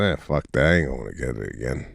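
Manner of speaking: low, lazy dismissive dog voice; pet voice. Retold or imitated. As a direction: grumble it as an unwilling dog. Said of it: "He goes".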